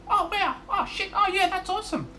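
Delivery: very high, excited mate's impression; high-pitched goofy mate voice; the Blythe voice. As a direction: squeak it out like a clueless, delighted bloke. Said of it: "he had a funny voice. The Blythe voice".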